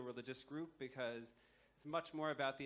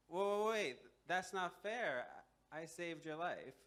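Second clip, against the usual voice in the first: higher, urgent objection easing slightly; indignant protest; 5 semitones higher, 4 dB louder, slower than usual. Acted. Man to the snake about to eat him, indignant protest. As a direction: break in loudly, then plead the case.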